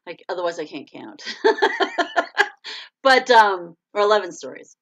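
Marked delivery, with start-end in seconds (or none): 1.3-3.0 high-pitched giggling laugh. Real, not acted. Host laughing at herself for miscounting stories. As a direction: laugh loudly at your own slip.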